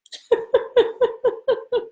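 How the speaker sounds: high, long giggle; a real burst of high laughter. Real, not acted. Laughing at rice voice joke.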